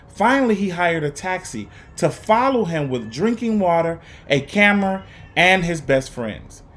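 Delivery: put-on expressive read-aloud for young students; lively, paced story reading with dramatic pauses; storybook narration. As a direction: read it like a teacher sharing a picture book with the class. Teacher reads picture book aloud.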